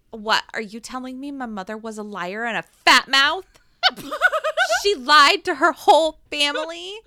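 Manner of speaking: loud, indignant outburst; indignant outrage. Retold or imitated. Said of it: "She's like".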